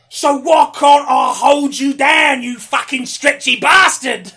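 furious, drawn-out high shout; Trapster. Acted. Trapster failing to hold down his foe, furious yell. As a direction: bellow it in total frustration. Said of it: "channeling the frustration of my character".

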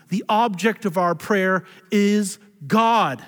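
ordinary delivery

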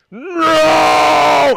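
anguished cry of No; dying shout. Retold or imitated. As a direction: scream it as he dies.

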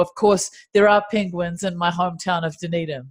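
ordinary delivery

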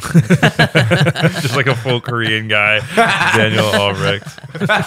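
loud, drawn out through laughter; laughing. Real, not acted. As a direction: crack up while getting the words out. Cracking up over the name joke.

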